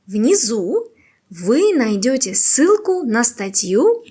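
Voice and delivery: ordinary talk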